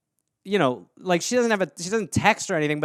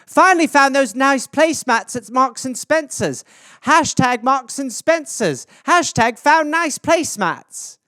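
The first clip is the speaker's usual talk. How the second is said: higher mock-mom voice, the hashtags slow; imitating mom's posts; 6 semitones higher, slower than usual. Mother posting about her placemats, in a higher slow mock voice. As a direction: play a delighted mother reading her post aloud. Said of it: "That's all it would be".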